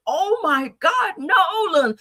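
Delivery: put-on high, slow panicked cry of the girl; imitating a distress call. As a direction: stretch it out like a frightened girl calling for help. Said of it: "that was a distress call".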